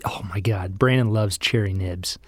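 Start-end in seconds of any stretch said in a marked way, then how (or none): 0.0-0.8 breathy excited whisper. Real, not acted. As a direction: whisper it in delight. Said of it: "excited whisper about candy".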